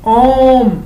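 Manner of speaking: ओम held long, the ओ stretched like a call from afar; long drawn-out vowel. Shown as a demonstration. Teacher stretching ओम to show the pluta vowel to students. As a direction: stretch the ओ in ओम to three beats. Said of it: "इस तरह का जब हम उच्चारण करते हैं".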